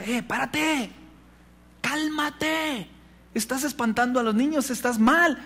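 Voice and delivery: high-pitched, strained self-reproach; inner voice scolding. Retold or imitated. Pastor's own mind telling him to stop, high scolding. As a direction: scold yourself in a high, held-back cry. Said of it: "mi mente decía".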